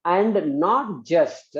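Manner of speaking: slow, spaced-out dictation. Shown as a demonstration. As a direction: dictate slowly for students to write.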